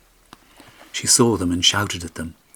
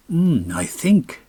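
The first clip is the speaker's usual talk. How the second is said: very slow, high hesitant musing; peering slowly; 8 semitones higher, 3 dB louder, much slower than usual. A narrator's reading. Askeladd peering far ahead, very slow and hesitant. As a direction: stretch it out while peering. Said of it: "peering into the distance".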